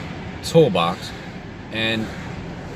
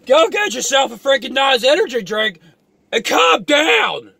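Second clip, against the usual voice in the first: exasperated shout; 10 semitones higher than usual. Real, not acted. Telling the doom guy to calm down, high exasperated shout. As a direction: yell it at him, totally fed up.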